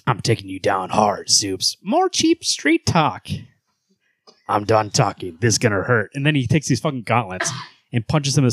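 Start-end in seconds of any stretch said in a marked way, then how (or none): 0.1-1.8 low tough-guy threat; Batman. Acted. Host threatening Superman, low Batman voice. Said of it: "low voice for Batman". 1.8-3.3 high, drawn-out scornful hero; Superman. Acted. Hero scoffing at Batman, high scornful voice. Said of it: "a higher voice for Superman". 4.5-6.1 low, terse threat; Batman. Acted. Host threatening Superman, low Batman voice. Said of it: "low voice for Batman".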